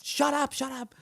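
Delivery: high, strained "shut up" yelled back at his mom; mock yell. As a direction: snap it like a fed-up son.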